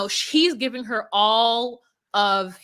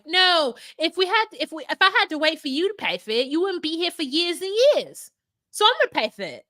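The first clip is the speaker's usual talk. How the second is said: put-on lightly higher, insistent girlfriend; mock insistence; 6 semitones higher than usual. Girlfriend overruling him on the visa, mild insistent mock. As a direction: press it like she's decided. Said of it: "she's like".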